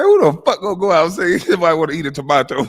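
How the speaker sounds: fast quote broken up by laughter; laughing while quoting. Real, not acted. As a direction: rattle it off, cracking up. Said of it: "this shit had me dying laughing".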